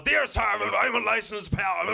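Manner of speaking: put-on blustering, spluttering cross old colonel; loud, high, drawn-out outraged bluster. Retired colonel complaining to the BBC, cross blustering. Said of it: "they all sound like Colonel K from Danger Mouse".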